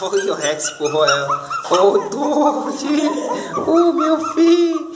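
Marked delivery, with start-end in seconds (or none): 1.7-4.9 high, tender coo over the puppies with a laugh. Real, not acted. Owner cooing at her crying puppies, high and tender. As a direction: melt over the crying pups, higher.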